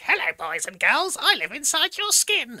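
put-on slow, high, sing-song creepy kids' TV host; a seventies kids' show host gone creepy; creepy children's presenter. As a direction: lure the children in, sweet and sinister. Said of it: "sounded like a kind of creepy seventies children's character".

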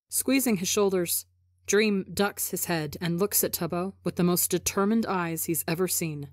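plain reading voice

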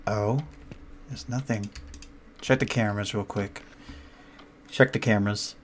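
hushed, tense and fast; scared horror game streamer. Real, not acted.

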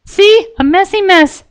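very high, bright cartoon pony voice; high squeaky cheerful pony girl; Pinkie Pie voice. A narrator's reading. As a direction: chirp it like a giddy little pony.

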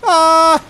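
high loud scream; sudden pained yelp. Real, not acted. Hit by boss.

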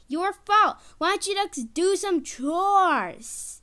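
high, loud indignant complaint; indignant scolding. Acted. Baby blames the family for the dirty ground, indignant.

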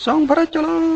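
high, ringing cheer of long live; crowd acclaim. A narrator's reading. Villagers hail the royal couple at the temple gate, ringing high cheer. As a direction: hail the royal pair at the top of the voice. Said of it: "ส่งเสียงแซ่ซ้องดังกึกก้อง".

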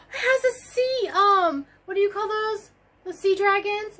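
normal talking voice

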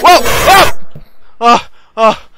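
loud, high frightened outbursts; scared loud cries; panicked yelling. Real, not acted. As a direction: shout in real fright as it lunges.

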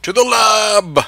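heroic shout. Acted. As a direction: shout it like a charge order. Heroic call to action.

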